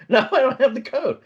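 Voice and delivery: high mock exasperation; exasperated reply. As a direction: plead with the doorman, voice squeezed high. Retold reply to doorman.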